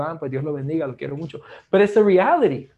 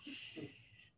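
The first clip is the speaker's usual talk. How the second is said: whispered shush; 10 semitones higher, 27 dB quieter than usual. Acted. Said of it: "Y después grita".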